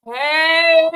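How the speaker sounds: loud, high, drawn-out mock holler; mocking dumb ass. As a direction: blare it out to make them sound foolish. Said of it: "That's what you sound like".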